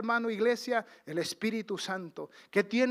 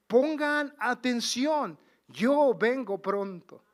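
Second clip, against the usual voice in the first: emphatic warning; 4 semitones higher, 5 dB louder, slower than usual. Acted. God's warning quoted, emphatic.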